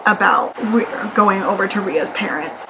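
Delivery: ordinary talk, nothing marked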